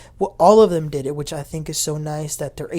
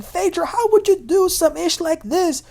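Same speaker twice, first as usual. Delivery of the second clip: indignant; 12 semitones higher, 5 dB louder than usual. Retold or imitated. Wife confronts accused friend.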